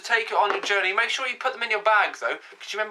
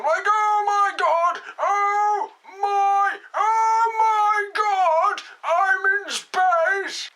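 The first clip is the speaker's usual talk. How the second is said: high, slow, drawn-out cry of joy; ecstatic squeal; 12 semitones higher, 3 dB louder, much slower than usual. Acted. Vlogger squealing to viewers about being in space, high and drawn out. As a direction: gush as if floating in orbit for the first time.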